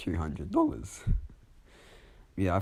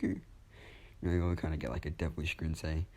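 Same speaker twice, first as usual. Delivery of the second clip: usual speaking voice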